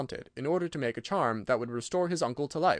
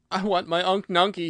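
put-on high, loud childlike plea; tearful protest; 7 semitones higher, 6 dB louder than usual. Ojo wanting his uncle back, tearful protest. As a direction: plead for him as if upset. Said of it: "Ojo began to cry".

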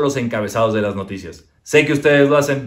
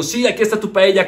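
fake cheerful; 8 semitones higher than usual. Husband passing off molletes as paella, higher. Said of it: "le digo".

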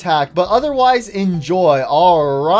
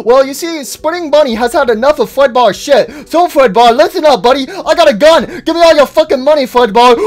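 high, lively cartoon host voice; chirpy show host; 6 semitones higher, 5 dB louder than usual. Host bunny fed up with co-star, chirpy then menacing. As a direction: turn from cheery host to loud threat.

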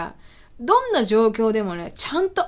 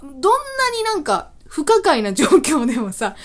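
ordinary delivery

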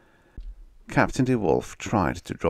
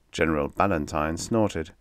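plain reading voice

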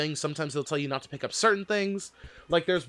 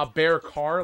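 normal talking voice

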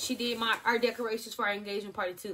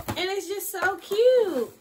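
gushing; 9 semitones higher, 10 dB louder, much slower than usual. Real, not acted. Gushing over baby gift.

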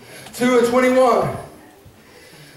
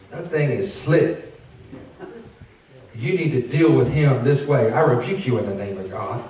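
stern Adam; 9 semitones lower than usual. Retold or imitated. Adam voiced instructing Eve.

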